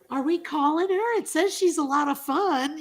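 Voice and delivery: put-on high, loud little-kid voice; kid reading aloud. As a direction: imitate a nosy child piping up loudly. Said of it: "the kid's in there like".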